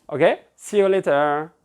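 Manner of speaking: usual speaking voice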